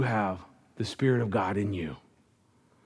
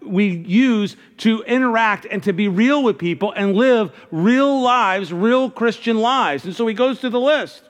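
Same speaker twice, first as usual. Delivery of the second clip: higher, louder, driving pulpit delivery; preaching at full force; 8 semitones higher, 5 dB louder than usual. Acted. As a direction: preach it out to the whole room. Preaching at full force, raised pitch.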